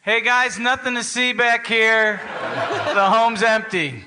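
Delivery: lightly hollered all-clear to companions; calling out. Acted. Lookout at the window to waiting friends, calling out.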